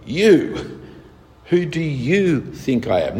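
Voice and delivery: emphatic "you" as in the Greek. Shown as a demonstration. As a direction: lean hard on the word "you", then ask slowly. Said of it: "actually in the Greek it's emphatic".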